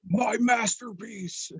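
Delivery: drawn-out dismayed villain; disappointed villain. Retold or imitated. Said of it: "Gorman looks at him and goes".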